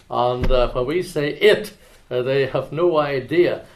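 usual speaking voice

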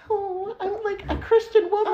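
high, laughing mock prim lady voice; prudish church lady. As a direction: play a shocked churchgoing woman. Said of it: "You're like".

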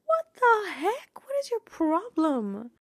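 high, drawn-out mock indignation; aghast outburst. Retelling her reaction to the cop's remark, aghast and high-pitched. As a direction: stretch it out in high-pitched disbelief. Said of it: "I gasped".

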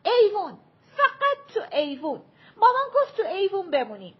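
put-on high, loud child's yell; loud calling out, fast at the end. A narrator's reading. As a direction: shout the rule after the running kids. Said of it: "راگو داد زد".

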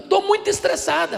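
high, loud imitation of a stressed little girl; menina estressada. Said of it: "uma menina de onze anos". (imitação de menina estressada, voz bem aguda)

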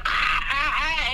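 high, hammed-up screams; mock scream. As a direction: fake scream of a man being dragged. Husband screaming as pulled under.

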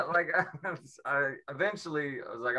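ordinary talk, nothing marked

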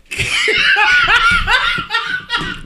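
big burst of laughter; cracking up loudly; loud high laugh. Real, not acted.

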